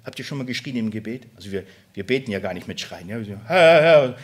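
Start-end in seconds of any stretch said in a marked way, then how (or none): 3.5-4.2 loud, high "Herr, Herr" cried out; crying out in prayer. Shown as a demonstration. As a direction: call out to the Lord in prayer. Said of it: "richtig schreien".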